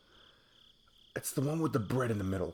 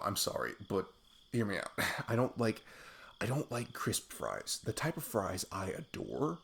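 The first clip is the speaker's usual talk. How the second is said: ordinary delivery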